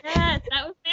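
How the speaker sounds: ordinary delivery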